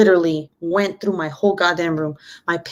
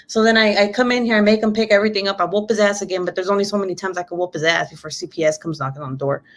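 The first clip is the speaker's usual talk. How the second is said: ordinary talk, nothing marked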